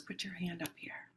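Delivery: soft unvoiced whisper; hushed healer's whisper; whispered instruction. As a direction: murmur it like a secret. Quoting the healer softly.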